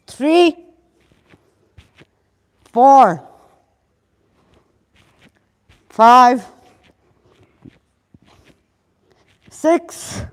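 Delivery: loud, punchy fitness count; coach counting reps. Acted. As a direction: call the reps out to the class. Counts her own round aloud.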